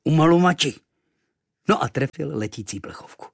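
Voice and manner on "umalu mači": high, loud exclamation before the shot; foreign war cry. A narrator's reading. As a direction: call it out like a spell. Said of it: "vyřkl zase". (bojový pokřik)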